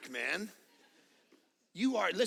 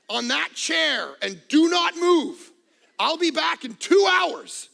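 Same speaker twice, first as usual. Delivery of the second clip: wife's stern orders; 5 semitones higher, 8 dB louder than usual. Retold or imitated. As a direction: lay down the law like a strict wife. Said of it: "my wife's like".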